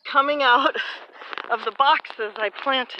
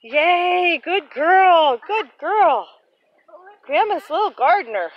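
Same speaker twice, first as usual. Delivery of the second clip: high, loud sing-song praise; squeaky praising; 6 semitones higher, 6 dB louder than usual. Real, not acted. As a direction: praise the little one brightly. Praising toddler, not a dog.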